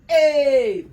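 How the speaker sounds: loud, high falling "hey"; hyped shout. Real, not acted. Rapper hyping his comeback, loud high shout. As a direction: yell it out, swooping down from high.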